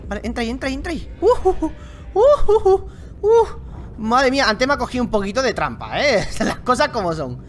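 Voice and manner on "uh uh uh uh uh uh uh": panicked uh. Real, not acted. Close call with monster.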